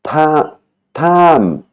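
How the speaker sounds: tam pitched high; Thai tone demo. Shown as a demonstration. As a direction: tam sung up high to show the tone. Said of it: "qui veut dire suivre".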